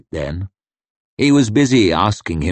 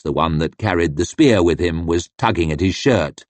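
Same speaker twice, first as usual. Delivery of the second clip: usual speaking voice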